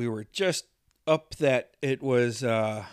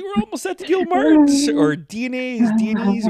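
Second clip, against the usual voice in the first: annoyed complaint, laughing; 12 semitones higher, 5 dB louder than usual. Retold or imitated. Driver annoyed, retold.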